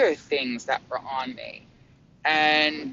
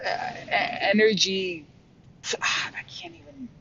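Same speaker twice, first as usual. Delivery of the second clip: tearful, overwhelmed; 5 semitones higher, 3 dB quieter than usual. Real, not acted. Overwhelmed describing healing.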